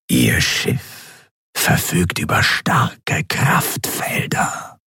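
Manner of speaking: slow, whispered voice of command; menacing Excellency. A narrator's reading. Ruler demanding the admiral's report, slow menacing whisper. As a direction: whisper each word with drawn-out authority. Superior challenges the account.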